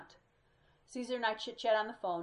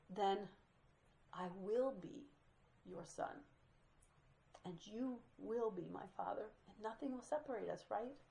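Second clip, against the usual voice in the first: soft, breaking, tearful voice; gentle sobbing; 8 dB quieter than usual. Retold or imitated. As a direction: speak through quiet tears. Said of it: "a cadence of gentle sobbing".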